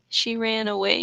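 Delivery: usual speaking voice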